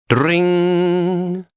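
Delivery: a high, long sung-out "Dring!" like a doorbell; doorbell ring imitated. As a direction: ring it out high like an electric bell.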